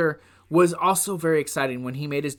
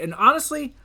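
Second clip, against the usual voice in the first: normal talking voice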